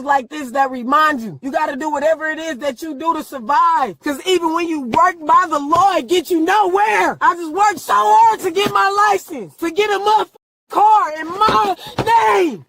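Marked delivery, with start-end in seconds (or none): 4.8-12.6 rising into a furious yell; angry shouting. Real, not acted. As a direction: shout it out in raw anger. Arrested woman yelling at officer.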